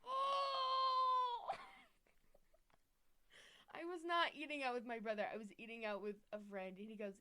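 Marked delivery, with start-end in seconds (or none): 0.0-3.7 high loud shocked oh; shocked gasp. Real, not acted. Shocked reaction to question. 3.7-5.2 laughing protest. Real, not acted.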